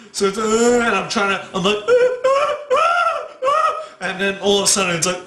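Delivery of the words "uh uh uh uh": very high, repeated wails. Retold or imitated. Cat crying outside at night, high baby-like wailing. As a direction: imitate an escalating baby-like cat wail.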